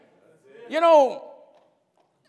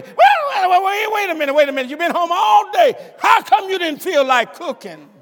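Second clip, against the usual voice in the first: mock indignant husband; 5 semitones higher than usual. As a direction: play the fussing husband, hammed up. Husband scolding wife, acted.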